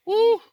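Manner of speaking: sharp, very high, loud whoop; woo. Real, not acted. Loud whoop warning Dak about his contract. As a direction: let out a big yelp of dread. Imagining Dak's contract fallout.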